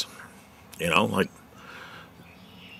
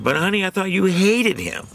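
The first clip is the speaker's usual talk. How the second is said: aunt's surprised quote; 11 semitones higher, 3 dB louder than usual. Retold or imitated. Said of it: "And Aunt Madge said".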